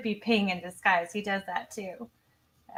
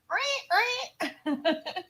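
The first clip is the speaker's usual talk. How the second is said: high-pitched mimicked "reet, reet" stab noise; stabbing sound effect; 10 semitones higher, 4 dB quieter than usual. Host mimicking the stabbing sound effect she loves, high screech. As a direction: screech out two high stabs, then laugh.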